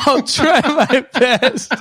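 drawn out slow through laughter; can barely get it out for laughing; slow, high, laughing delivery. Real, not acted. Laughs retelling his own line.

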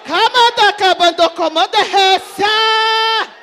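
loud, fervent tongues, the words run long; speaking in tongues. Real, not acted. Preacher to church, praying loudly in tongues. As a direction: burst into ecstatic glossolalia.

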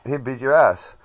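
ordinary talk

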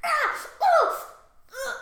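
high, loud mock fighting yells; sudden exertion cries; battle grunts. Boyfriend swinging his axe in battle, mock yells.

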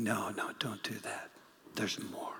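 low, hushed plea; Paul pleading in a whisper; urgent whisper. Retold or imitated. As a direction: whisper it like a gentle warning.

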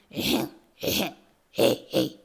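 loud, low mock coughs; throat clearing. A narrator's reading. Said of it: "咳払いをしている".

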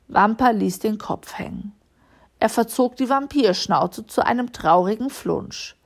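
ordinary delivery, nothing marked

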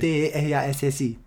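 letter by letter; spelling it out. Shown as a demonstration. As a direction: spell each letter out clearly. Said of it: "Como é que se escreve Terassi?".

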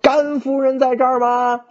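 high, loud calling out across a crowd; loud shout. A narrator's reading. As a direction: shout the question high over the people. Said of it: "大声喊道".

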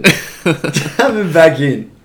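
slow, laughing mock repeat; mocking through laughter. Player ridiculing his teammate's call, slow laughing mockery. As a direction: repeat his words slowly while laughing. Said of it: "I was like".

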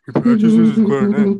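very loud, high cartoon scream; full-out high-pitched yell. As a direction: scream it like an outraged cartoon character. Reads screamed chat line in character.

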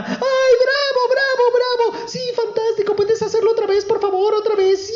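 very high delighted squeals; cheering princess. Acted. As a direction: cheer him on like a thrilled little girl.

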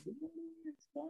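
high soft sing-song morning greeting; lightly voiced high cooing to the baby; baby-talk greeting. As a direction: coo it to a baby. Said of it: "And I go".

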